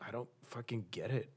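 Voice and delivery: ordinary delivery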